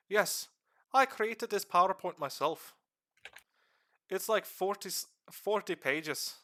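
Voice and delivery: rough put-on Irish accent held through a lore talk; streamer's half-Irish accent. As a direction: explain the lore in a loose, slipping Irish accent. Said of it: "I'm not even sure what kind of accent I'm doing right now".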